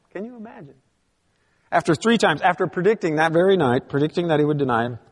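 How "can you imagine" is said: near-whispered wonder. Real, not acted. Repeated softer, higher.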